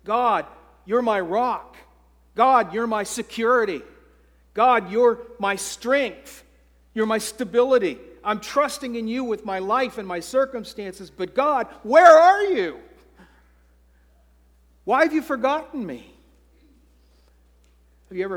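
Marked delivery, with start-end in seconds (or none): 0.0-11.1 rising, louder earnest plea to God; David pleading with God. Acted. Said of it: "Look how he begins". 11.1-16.1 much higher, loud desperate cry to God; anguished cry. Acted. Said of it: "Look how he begins".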